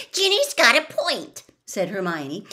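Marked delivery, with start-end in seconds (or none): 0.1-1.4 much higher and louder, suddenly eager; eager high outburst of agreement; perking up. A narrator's reading. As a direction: jump in, brightening at once. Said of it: "perking up at once".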